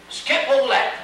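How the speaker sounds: quick, loud interruption; hasty cry. A narrator's reading. Bellman hastily cutting off the baker's story. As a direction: cut him off in a hurry. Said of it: "cried the bellman in haste".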